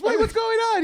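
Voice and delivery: high, loud alarmed question; panicked character voice. Retold or imitated. Retelling the show, mimicking the husband's high alarmed panic. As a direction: blurt it in sudden panic. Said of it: "He goes".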